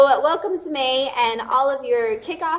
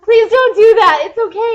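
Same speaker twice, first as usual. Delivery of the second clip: embarrassed pleading; 11 semitones higher, 15 dB louder than usual. Retold or imitated. Embarrassed fan begging actor.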